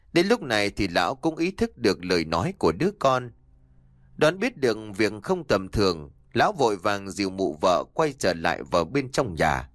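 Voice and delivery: plain reading voice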